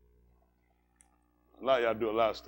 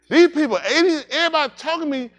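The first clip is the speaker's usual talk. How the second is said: put-on baby overwhelmed; 9 semitones higher, 9 dB louder than usual. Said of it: "baby like".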